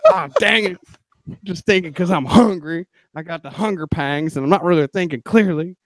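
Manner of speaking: high mock-Texan voice; hammed-up Texas accent; attempted Texas accent. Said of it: "I'm trying to do a Texas accent".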